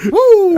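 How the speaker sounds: sudden high, loud woo; awkward whoop. Retold or imitated. Overwhelmed father at the birth, loud whoop. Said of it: "And I went".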